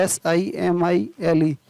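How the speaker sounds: ordinary talk, nothing marked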